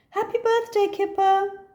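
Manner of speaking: high, loud birthday cheer; shouting happy birthday; cheerful greeting. Acted. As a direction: friends at the door. Friends greet at the door.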